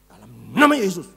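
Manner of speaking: loud commanding rebuke; forceful prayer rebuke; rebuking shout. Shown as a demonstration. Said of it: "saya berdoa, saya tengking".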